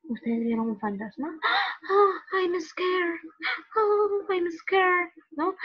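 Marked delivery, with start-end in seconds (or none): 1.6-3.2 high, gasping mock fright; drawn-out scared cry after a gasp; acted fright. Shown as a demonstration. Teacher acting out seeing a ghost, scared. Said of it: "estoy asustado". 3.5-5.5 high, gasping mock fright; acted fright. Shown as a demonstration. Teacher acting out seeing a ghost, scared. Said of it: "estoy asustado".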